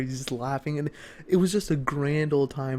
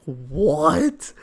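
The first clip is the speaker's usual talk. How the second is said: shocked what; 6 dB louder than usual. Retold or imitated.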